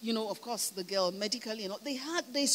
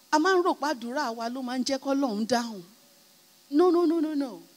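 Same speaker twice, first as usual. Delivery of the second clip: loud, higher, emphatic preacher delivery; forceful sermon peak; preaching at full force; 4 semitones higher, 8 dB louder than usual. Real, not acted. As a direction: proclaim it to the congregation with force.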